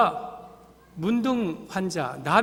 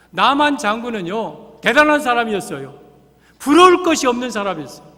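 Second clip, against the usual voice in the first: emphatic preaching; 3 semitones higher, 7 dB louder than usual. Acted. Preaching at full force.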